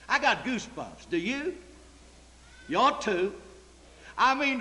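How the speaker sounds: ordinary talk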